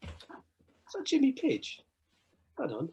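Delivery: high, slow, stunned question; awed disbelief. Retold or imitated. Fan spotting [name] at the airport, stunned. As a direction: drag it out in starstruck disbelief.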